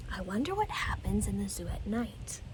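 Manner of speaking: soft bedtime whisper; Michael wondering in bed, whispered. Acted. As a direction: breathe it under the covers.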